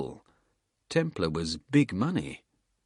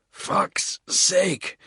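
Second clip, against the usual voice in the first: gasping; 10 semitones higher than usual. Acted. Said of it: "Bald gasped".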